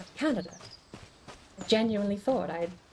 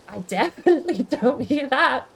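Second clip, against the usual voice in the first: laughing; 5 semitones higher, 8 dB louder than usual. Real, not acted. Amused denial of accent guess.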